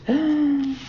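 high, sharp gasp of wonder. A narrator's reading. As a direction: suck in a breath in awe. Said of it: "They all gasped".

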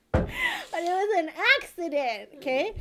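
laughing excuse. Real, not acted. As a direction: plead it while still laughing. Laughing defends herself.

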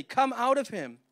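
loud, commanding rebuke; stern command. A narrator's reading. Jesus casting out the demon, loud stern order. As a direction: order the spirit out with authority. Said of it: "he said it sternly".

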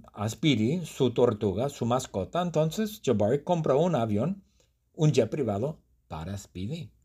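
ordinary talk

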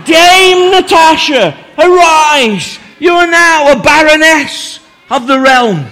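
loud, high ceremonial shout; solemn regal knighting. Pretend. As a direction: proclaim the title grandly. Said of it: "I'm gonna get close to your ears".

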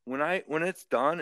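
ordinary talk, nothing marked

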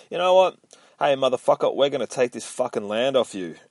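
high, loud mock soldier threat; retold soldier shouting down a farmer, mock aggressive; threatening soldiers. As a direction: bark it like a thug soldier, half-hammed. Voicing soldiers threatening old farmer.

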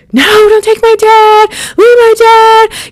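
high, loud wail of protest; screaming child. Retold or imitated. Frightened kids pleading for their dad, screaming. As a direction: cry out in panic, drawing out the plea. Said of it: "You know, we're screaming".

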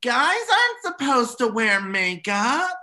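put-on high, loud child's protest; five-year-old nephew. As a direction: imitate an indignant little boy. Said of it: "my five-year-old nephew".

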